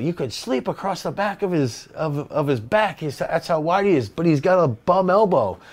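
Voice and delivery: a hint of a Canadian farmer's accent; thick Canadian accent. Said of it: "with his thick Canadian accent".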